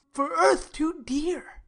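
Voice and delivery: ordinary talk, nothing marked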